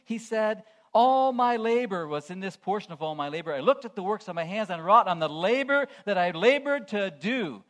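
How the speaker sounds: loud, ringing pulpit delivery; full-force preaching. Acted. Preacher rousing the congregation about earthly labor, loud ringing preaching. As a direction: preach it to the back pews.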